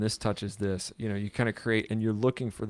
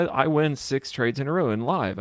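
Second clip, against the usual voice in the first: ordinary talk, nothing marked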